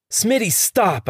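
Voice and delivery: sharp high yell. Acted. Henry stopping Smitty's attack, a sharp high yell. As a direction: shout him off, alarmed. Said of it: "I yell".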